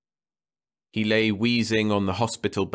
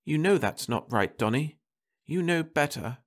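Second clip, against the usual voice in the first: wife's voice lifted higher and gentle; anxious wife at the sickbed; Martha's voice; 8 semitones higher than usual. A narrator's reading. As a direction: voice the worried wife, pitched up.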